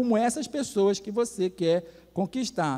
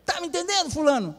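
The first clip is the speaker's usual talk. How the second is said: high, insistent check on obedience; stern matriarch; 7 semitones higher than usual. Retold or imitated. Grandmother confronts her grown son, high and stern. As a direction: press for obedience like an imperious mother. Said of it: "Aí ela bateu na mesa".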